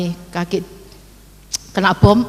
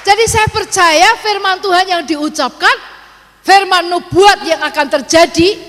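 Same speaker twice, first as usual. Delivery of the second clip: higher and louder proclamation; preaching at full force; 9 semitones higher, 4 dB louder than usual. Acted. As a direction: proclaim it to the congregation. Preacher climaxes on faith. (berkhotbah dengan lantang)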